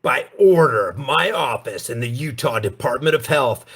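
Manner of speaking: low, menacing villain read of the order; deep sinister voice. Retold or imitated. Mocking the governor's order to his viewers, in a deep sinister voice. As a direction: make it sound imposing and sinister, like a tyrant with fangs out. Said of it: "You need to say this with a deep voice, an imposing voice, a sinister voice".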